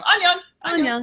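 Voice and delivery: very high-pitched child imitation; put-on little boy voice, way up high; little Korean boy. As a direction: pipe up with the name like a small boy. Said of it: "says his name back every time".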